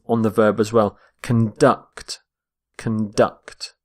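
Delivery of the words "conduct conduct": "Conduct" as con-DUCT, weight on the end; stress on second syllable. Shown as a demonstration. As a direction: say "Conduct" with a reduced first syllable and strong DUCT. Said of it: "note the stress of".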